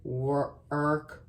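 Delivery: slow, drawn-out "work"; stretched sound-out. Shown as a demonstration. Teacher sounding out "work" for young scholars, stretched. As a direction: sound out "work" slowly for the class.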